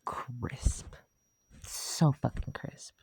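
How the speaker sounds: whispered, savoring each word; breathy whisper. Real, not acted. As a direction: whisper it in awe. Savoring the memory.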